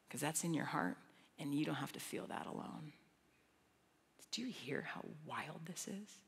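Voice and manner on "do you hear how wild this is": whisper. Real, not acted. Awed aside to listeners.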